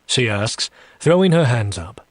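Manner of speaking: plain reading voice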